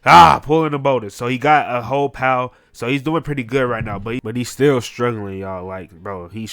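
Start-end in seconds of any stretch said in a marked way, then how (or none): 0.0-0.4 loud, raised grunt-scream of effort; imitated strained scream. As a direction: cry out as if hauling boulders. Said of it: "He's screaming".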